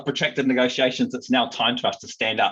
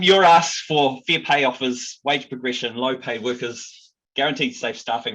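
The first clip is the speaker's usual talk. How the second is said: ordinary talk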